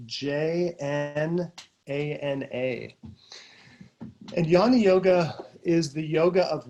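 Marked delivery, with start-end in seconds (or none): 0.1-3.0 slow, letter by letter; measured spelling, one letter at a time; spelled out. Real, not acted. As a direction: spell it out slowly, each letter apart.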